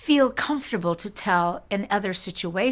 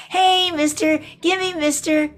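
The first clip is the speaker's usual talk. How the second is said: put-on louder, higher child's call for trinkets; kids shouting; 9 semitones higher, 5 dB louder than usual. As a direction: call out like a kid at a parade.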